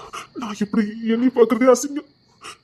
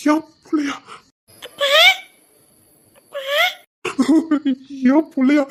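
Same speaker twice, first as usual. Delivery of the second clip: louder and higher each time, from shock to outcry; rising disbelief; 7 semitones higher, 4 dB louder than usual. Acted. To his sister, realising he is a father: rising cry. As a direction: let the disbelief burst out.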